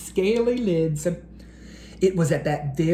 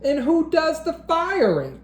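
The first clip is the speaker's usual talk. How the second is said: haughty dragon librarian; 8 semitones higher than usual. A narrator's reading. The dragon librarian sneers back at the principal. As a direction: fire back at the principal with scorn. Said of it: "asked Miss Scales with a glare".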